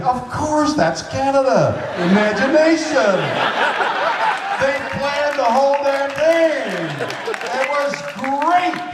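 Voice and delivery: high, laughing wonder at the fake lift; delighted inner thought. Shatner recalling his own thoughts, a delighted high gush. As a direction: gush about it like a charmed fan. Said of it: "And I think".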